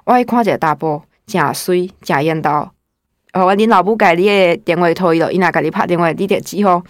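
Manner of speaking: grandma's words in Minnan dialect, lightly voiced; quoting grandma in her native Minnan. Grandma pressing her to answer the phone, in Minnan. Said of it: "我外婆就用闽南话讲".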